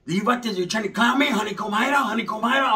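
lightly voiced offended homeboy; angry uncle. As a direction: bristle at being clowned.

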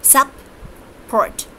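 teacher sounding out "sup-port", sup... port; split into syllables. Shown as a demonstration. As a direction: break "sup-port" cleanly after sup. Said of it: "support has two syllables".

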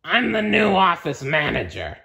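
strict monster manager voice; bossy monster. Acted. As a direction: announce it like a stern new boss. Monster office manager speaks.

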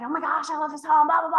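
quick, light imitation of a gushing buyer; hammed-up buyer excitement; mock gushing buyer. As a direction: breeze through it like a starry-eyed buyer. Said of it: "if they're all excited".

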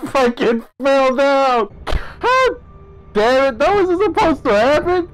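high-pitched, startled cursing; rattled outcry. Real, not acted. Gamer who just fell in the game, high rattled outcry. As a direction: cry out in shock after a fall. Player has just fallen down.

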